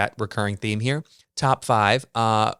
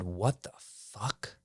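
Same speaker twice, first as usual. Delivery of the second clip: slow, hushed, much softer disbelief; stunned aside; 12 dB quieter, much slower than usual. Retold or imitated. Guest spots hidden robes and mutters in slow, hushed disbelief. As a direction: breathe it out half to yourself, stunned.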